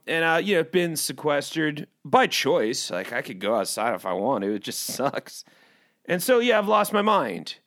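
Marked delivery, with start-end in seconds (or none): none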